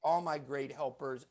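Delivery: usual speaking voice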